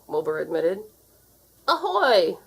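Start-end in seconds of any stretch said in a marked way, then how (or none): 1.7-2.4 high, loud sailor's hail; called out. A narrator's reading. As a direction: hail them from afar. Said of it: "called Uncle Art".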